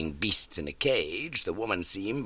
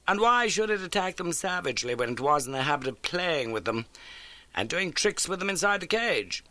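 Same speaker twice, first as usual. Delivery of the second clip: a higher, brisk Holmes voice; crisp, keen detective laying out facts; 8 semitones higher than usual. Acted. Holmes briskly telling Watson of the old case.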